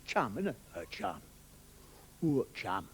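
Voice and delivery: low, soft London menace; Londoner. Acted. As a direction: threaten quietly like a Londoner. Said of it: "The Londoner".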